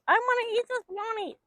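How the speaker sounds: high squeaky pet voice for the rooster; voicing the rooster. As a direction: speak for the bird in a tiny high voice. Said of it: "He's like".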